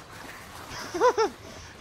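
high-pitched laughter; helpless laugh. Real, not acted. Gamer overwhelmed by zombies, helpless laugh. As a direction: burst into high giggling at the chaos.

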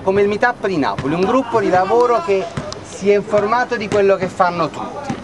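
ordinary talk, nothing marked

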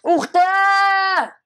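very high, loud, drawn-out scream; long piercing cry; shocked scream. Real, not acted. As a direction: shriek it out long. Shocked outburst in game.

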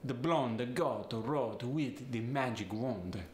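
Italian accent with short oh in "blonde" and "wand"; staccato Italian, extra uh after "blonde" and "goat". Shown as a demonstration. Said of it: "get the staccato oh sound".

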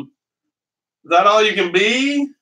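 slow, mocking schoolyard taunt; taunting kids. Teammates mock the flattened boy, slow jeering taunt. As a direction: drag it out to rub it in. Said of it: "the kids are up there going".

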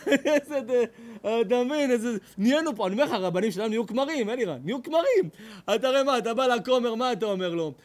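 ordinary talk

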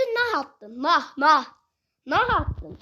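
ordinary talk

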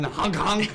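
high, loud spoken horn blast; playful loud double honk; mock truck honk. Imitates the coming truck's horn.

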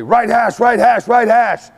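high, slow repeated call. Retold or imitated. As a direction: call the spot across the field. Coach calling hash.

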